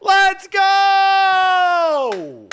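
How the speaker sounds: very high, long-held cheer; hyped shout. Real, not acted. Streamer celebrating the AI's French greeting, hyped shout. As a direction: yell it out in triumph, stretching the last word.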